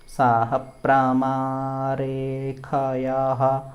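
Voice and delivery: slow, sustained syllables on a level note; syllable-by-syllable demo. Shown as a demonstration. Teacher sounding out the mantra for learners, very slow and drawn out. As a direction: draw out each long vowel. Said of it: "फिर से बोलेंगे इसको".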